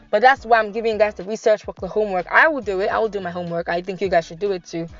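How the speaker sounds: ordinary talk, nothing marked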